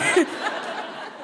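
a quick unvoiced burst of laughter; breathy laugh. Real, not acted. Laughing at own joke.

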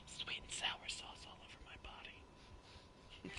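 slow, breathy whisper; slow seductive whisper; mock sultry whisper. Drive-thru order bit.

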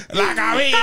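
high, loud jeer; teasing shout. Real, not acted. Host mocking the guest's big head, teasing shout. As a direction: yell it out to rib him.

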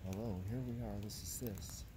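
usual speaking voice

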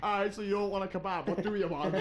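impatient Dublin server, hammed up; mock Dublin server. Dublin kebab-shop worker to the customer, mock Irish. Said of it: "they're like".

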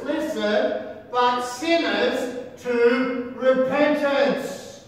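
a touch of preaching fire; preaching scripture. Real, not acted. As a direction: proclaim it to the crowd. Preacher voices Jesus' words forcefully.

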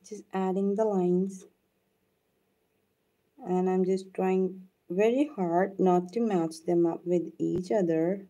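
ordinary talk

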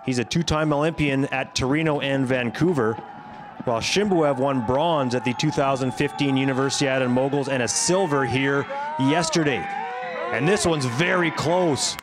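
ordinary talk, nothing marked

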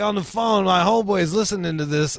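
usual speaking voice

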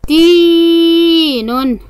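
long held ee in تين; تين said slowly with a sustained tee; elongated letter. Shown as a demonstration. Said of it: "حرف التاء ممدود بالياء".

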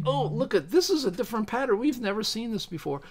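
high, fast gushing voice, lightly mocking scientists; hammed-up discovery, like a giddy researcher; mock excited physicist. Vlogger mimics physicists spotting a new pattern, mock excitement.

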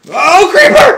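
high, loud panicked shriek. Real, not acted. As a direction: scream at the sudden monster. Shrieking at creeper.

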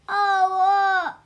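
sudden loud exclamation; startled loud oh. Real, not acted. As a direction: blurt it out loud. Child reacting loudly while reading.

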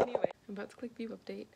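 ordinary talk

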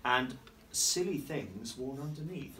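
slow, drawn-out rhyme reading; reading to children. A narrator's reading. Teacher reading rhymes to young pupils, slow and playful. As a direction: linger on each silly line. Reading rhyming picture book aloud.

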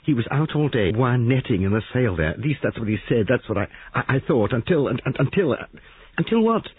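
high, tumbling, halting words; breathless jumble. Acted. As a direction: gasp it out in a rush, stammering. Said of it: "Molly spoke breathlessly, the words coming out in an incoherent jumble".

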